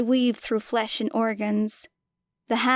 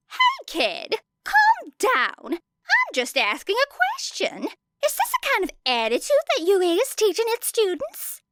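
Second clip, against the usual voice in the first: pushy reporter; 12 semitones higher than usual. A narrator's reading. Reporter badgering the girl.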